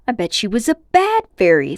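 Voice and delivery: put-on a touch of a sulky boy's thought; lightly voiced boy. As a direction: mutter it to yourself.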